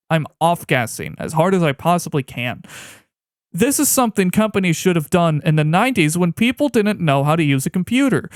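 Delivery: ordinary talk, nothing marked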